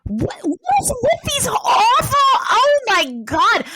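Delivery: drawn-out, high-pitched dismay; appalled outburst. Real, not acted. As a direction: protest the awful choice in a high, slow wail. Reacting to a gross dilemma.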